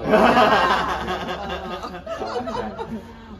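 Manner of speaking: hearty burst of laughter; laughing out loud. Real, not acted. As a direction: laugh loudly at the joke. Food stall banter, laughs.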